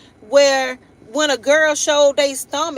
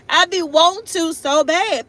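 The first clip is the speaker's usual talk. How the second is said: laughing; 6 semitones higher than usual. Real, not acted. Laughing confession about revenge.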